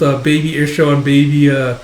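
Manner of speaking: ordinary delivery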